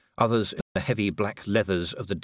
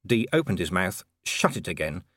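usual speaking voice

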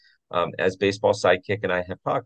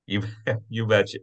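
Minnesota try. Retold or imitated. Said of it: "How's that?".

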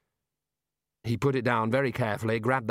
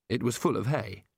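usual speaking voice

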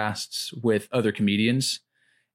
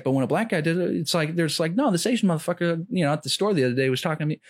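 raised, fast mock rant; imitating Bill Burr; 8 semitones higher, faster than usual. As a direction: rattle it off high and quick like the comic. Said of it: "He's like".

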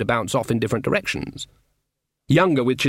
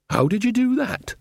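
put-on higher, curious old woman; amazed question; 9 semitones higher than usual. Nanny Ogg asks Granny, lightly voiced and amazed. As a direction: ask with wonder.

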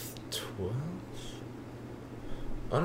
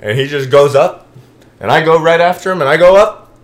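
higher and louder with each line; excited build; 7 semitones higher, 8 dB louder than usual. Retold or imitated. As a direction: build the jump like a thrill.

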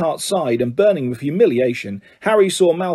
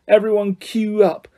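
mild mock roar; 6 semitones higher than usual. A narrator's reading. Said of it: "Malfoy roared to the crowd".

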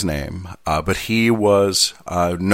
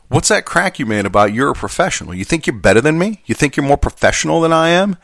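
mock indignant challenge, pitch climbing; angry coworker; 7 semitones higher than usual. Offended carrier confronts his substitute over a remark, mock angry. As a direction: imitate an affronted coworker picking a quarrel. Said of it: "and he's angry".

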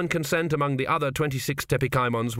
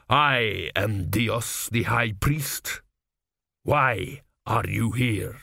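calm authority; much slower than usual. A narrator's reading. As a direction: state it slowly with indubitable authority. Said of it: "perfectly calm and reasonable voice, with overtones of concern but indubitable authority".

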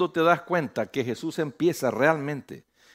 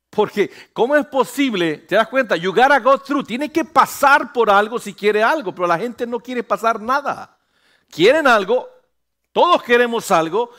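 higher, louder sermon climax; full-force preaching; 7 semitones higher, 7 dB louder than usual. Acted. Pastor urging the church to go through, full-force preaching. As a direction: drive the point home to the church.

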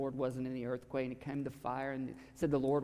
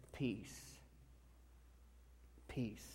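soft calm peace; 4 semitones lower, 15 dB quieter than usual. A narrator's reading. Jesus speaks calm to disciples.

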